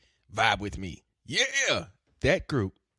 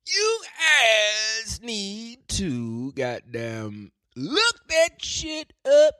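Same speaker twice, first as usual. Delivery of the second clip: high, loud, very drawn-out holler; hammed-up exasperated high yell; mock scolding; 11 semitones higher, 7 dB louder, much slower than usual. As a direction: drag out every word, building louder and higher.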